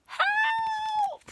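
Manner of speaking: very high, loud desperate scream; high, loud shout for help. Acted. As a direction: scream as the tears drown you. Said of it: "They screamed for help".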